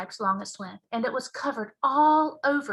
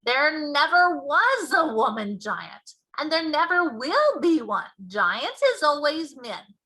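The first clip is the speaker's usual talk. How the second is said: big friendly giant, louder and slower; BFG giant voice; 6 semitones higher, 7 dB louder, slower than usual. Acted. Giant explaining to Sophie, loud and slow. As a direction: boom out the giant's mangled grammar.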